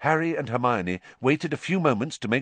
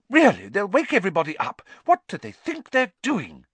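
high-pitched, fast scolding; indignant; 10 semitones higher, 4 dB louder than usual. A narrator's reading. Madame Pumphrey hears voices outside, indignant. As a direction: fuss crossly at the disturbance.

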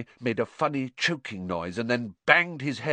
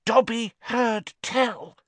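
high, slow elf voice; hoarse-meant elf voice; 4 dB louder, slower than usual. A narrator's reading. Dobby leaning in to Harry, slow high elf voice. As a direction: squeak it out slowly, portentously. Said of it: "he said hoarsely".

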